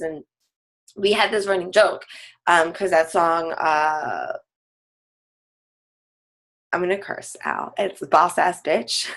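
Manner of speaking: ordinary delivery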